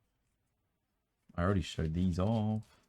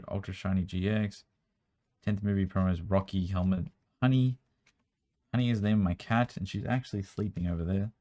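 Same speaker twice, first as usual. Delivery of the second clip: ordinary delivery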